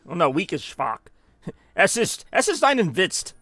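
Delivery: ordinary delivery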